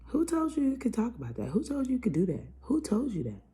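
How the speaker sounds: loud, high indignant who-questions; high indignant questioning. Retold or imitated. Vlogger retelling her challenge, loud indignant questions. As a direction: demand it, outraged and shrill-pitched. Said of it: "I kept saying".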